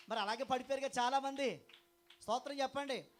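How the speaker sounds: loud, high fiery preaching; full-force preaching. Acted. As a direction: thunder it at the congregation. (గట్టిగా ఉత్సాహంగా ప్రసంగం)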